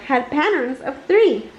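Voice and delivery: plain reading voice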